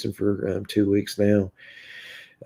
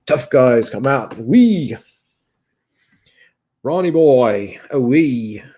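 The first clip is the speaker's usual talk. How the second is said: imitating Scottish accent; 3 semitones higher, 3 dB louder, slower than usual. Streamer mocking a Scottish rival's catchphrases, imitated accent. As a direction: lightly mimic a Scotsman's catchphrases. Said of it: "when you say Ronnie boy".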